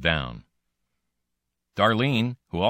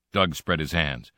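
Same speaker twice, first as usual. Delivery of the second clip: plain reading voice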